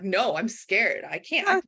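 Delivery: mock scared. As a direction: play the frightened inner voice. Human heart voiced as scared.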